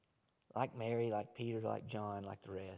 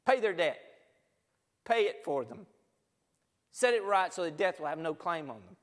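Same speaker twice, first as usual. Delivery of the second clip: loud, raised preacher's command; commanding Father; 5 semitones higher, 9 dB louder than usual. Acted. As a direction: issue urgent orders, voice lifted high and forceful. Father commanding Son in acted speech.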